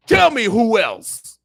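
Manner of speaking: high, loud, slow demand; demanding challenge. Real, not acted. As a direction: call out the crowd, slowly and loudly. Passionate challenge repeated.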